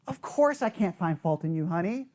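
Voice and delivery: low, soft mock reassurance; placating spouse. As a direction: soothe your partner, voice dropped. Placating spouse in acted argument.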